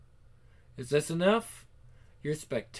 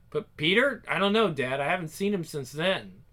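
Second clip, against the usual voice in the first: usual speaking voice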